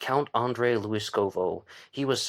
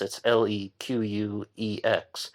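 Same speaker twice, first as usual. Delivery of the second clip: ordinary talk, nothing marked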